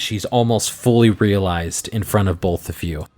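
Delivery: normal talking voice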